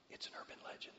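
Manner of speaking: soft unvoiced whisper of It's an urban legend; whispered aside. Real, not acted. Preacher reminding the congregation, whispered.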